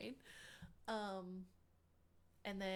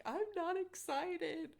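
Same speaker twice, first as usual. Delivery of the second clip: mock sulky protest; 10 semitones higher, 5 dB louder, much slower than usual. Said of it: "this is the funny part of the story".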